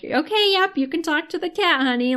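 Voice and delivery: high-pitched, placating mock reassurance; humoring a scared-for friend. Friend placating a woman who talks to cats, humoring. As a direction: imitate someone carefully humoring a friend. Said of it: "the friend's kind of scared for her".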